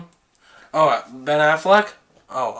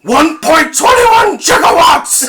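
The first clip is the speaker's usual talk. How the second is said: high, loud frantic scientist shout; panicked shouted exclamation; Doc Brown impression; 12 semitones higher, 7 dB louder than usual. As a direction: cry it out in alarm like a mad inventor. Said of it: "Doc Brown?".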